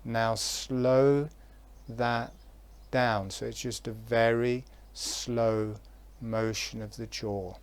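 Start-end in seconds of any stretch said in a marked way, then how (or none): none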